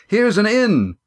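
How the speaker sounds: high-pitched call to a companion; shout. A narrator's reading. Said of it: "Estrella shouted".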